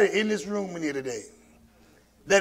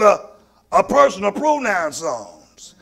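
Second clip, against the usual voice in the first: usual speaking voice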